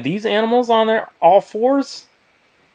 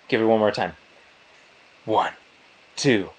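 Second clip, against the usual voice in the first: ordinary delivery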